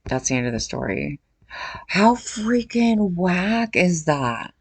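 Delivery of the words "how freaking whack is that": drawn-out disbelief. Real, not acted. Reacting to the story.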